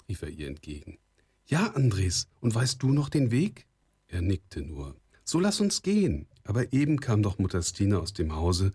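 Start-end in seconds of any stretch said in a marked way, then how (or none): 1.5-3.6 lightly voiced girl, pitched higher; higher girl's reply to her sweetheart; young girl. A narrator's reading. 5.2-6.3 put-on very high girl's voice; high, light maiden's voice; young girl. A narrator's reading. Girl voiced, urging him along.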